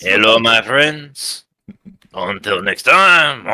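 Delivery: Kenku mimicry. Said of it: "you can perfectly mimic".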